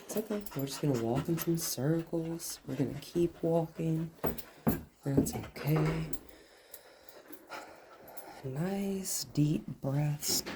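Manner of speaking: soft, slow, soothing to a nervous dog; calming the dog. Real, not acted. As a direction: ease her down gently, quietly.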